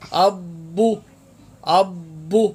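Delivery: اب with a ب echo like a short بو; qalqala demo. Shown as a demonstration. Teacher showing why a بو sound appears, bounced ب. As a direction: bounce the ب after اب. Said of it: "با پیش بو".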